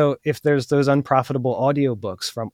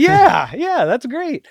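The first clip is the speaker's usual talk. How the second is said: much higher, delighted seller's yes; agreeable seller; 11 semitones higher, 6 dB louder, much slower than usual. Acted. Seller gladly taking the buyer's offer, high and agreeable. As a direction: agree eagerly in a high, lifted voice. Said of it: "And they go".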